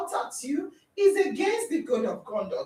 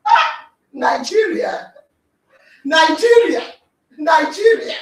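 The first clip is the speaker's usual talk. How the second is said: loud, crying-out Nigeria; exasperated shout; 6 dB louder than usual. Acted. Speaker to the audience, despairing about Nigeria, loud exasperated cries. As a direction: wail the country's name in exasperation.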